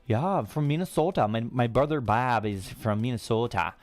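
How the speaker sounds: hammed-up Minnesota accent; mock Minnesota accent. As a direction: put on a rounded Minnesota accent. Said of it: "the Minnesota accent".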